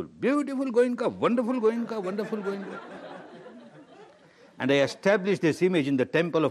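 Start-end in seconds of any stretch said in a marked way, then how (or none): 0.0-2.8 high, crooning praise of oneself; mock self-admiration. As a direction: fawn over your own image. Mocking admiration of own self-image.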